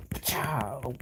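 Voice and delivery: breathy aside; hushed call of the move; whispered. Real, not acted. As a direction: whisper the name. Whispered attack name.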